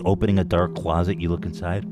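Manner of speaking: ordinary talk, nothing marked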